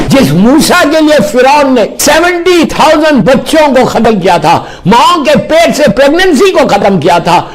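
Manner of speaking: impassioned sermon; fervent preaching. Real, not acted. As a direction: proclaim God's might. Preacher exalts God's power.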